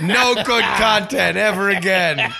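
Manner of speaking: loud, high, laughing sarcasm; laughing sarcastic shout. Acted. Mock sarcastic outburst, laughing.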